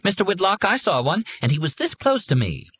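put-on high, piping little kid; a child telling the teacher about a raccoon; eager child. A narrator's reading. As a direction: squeak it out like an excited pupil.

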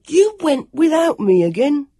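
high, loud, slow accusing cry; angry. A narrator's reading. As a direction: shout the accusation, drawn out. Sam angry at Jonathan.

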